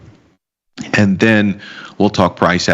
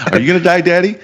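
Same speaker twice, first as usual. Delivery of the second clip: put-on high, small child's question; mock child asking daddy; 9 semitones higher, much faster than usual. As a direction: ask like a worried little kid. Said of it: "such a Russian response".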